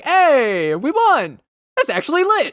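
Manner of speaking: high excited shout; excited gamer cheer. Retold or imitated. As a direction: cheer like you just won. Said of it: "I was like".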